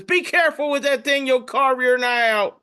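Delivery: high, scolding cartoon voice; warning pumpkin. Pumpkin warning another holding a knife, high scolding voice. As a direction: caution it like a fretting parent. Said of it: "one pumpkin tells another pumpkin".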